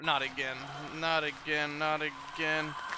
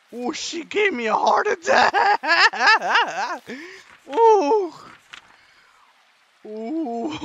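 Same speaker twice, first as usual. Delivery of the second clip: slow, high, louder laugh-crying through the words; shaken laughter; 9 semitones higher, 8 dB louder, much slower than usual. Real, not acted. Gamer recovering from a jump scare, laughing and half crying. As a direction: laugh off the fright, still shaking.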